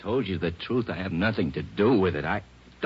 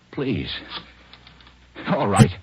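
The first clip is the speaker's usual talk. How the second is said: high pleading then loud giving in; beg, then give up; desperate plea; 4 semitones higher, 7 dB louder, slower than usual. Acted. Police call his wife.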